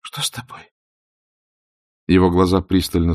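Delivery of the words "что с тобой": hushed, breathy whisper. A narrator's reading. Scarlett whispering to Ashley at his side. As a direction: whisper it close, touching his shoulder. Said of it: "прошептала".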